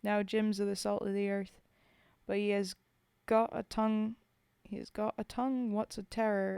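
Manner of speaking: put-on poem read in a rough British soldier's accent. Streamer reading Bill's Grave to chat, in a British soldier's accent. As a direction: tell it as a homesick Tommy at the grave.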